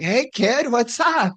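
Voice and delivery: light impression of an old wrestler; Dustin impression. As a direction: mimic an old friend's breezy hello. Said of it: "Yeah, total Dustin".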